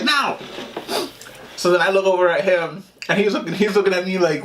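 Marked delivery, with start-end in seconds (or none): 0.0-0.4 very high sudden "No!"; shocked no. Retold or imitated. Mom trying to stop the prank, a high sudden cry. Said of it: "she's like".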